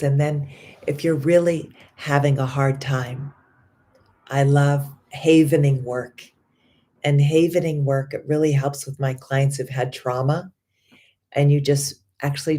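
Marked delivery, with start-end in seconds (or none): none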